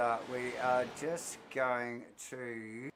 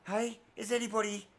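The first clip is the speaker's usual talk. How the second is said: calling out; 9 semitones higher than usual. Acted. Calling out to audience.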